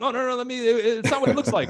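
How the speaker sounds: high, loud mock panic; hammed-up guilty excuse; caught stealing panic. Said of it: "You go fast".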